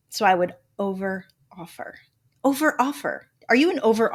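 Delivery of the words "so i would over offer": usual speaking voice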